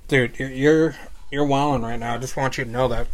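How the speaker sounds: usual speaking voice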